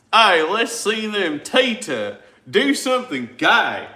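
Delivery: loud, high Southern drawl, stretched out; Southern accent. Acted. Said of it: "with a southern accent for sure".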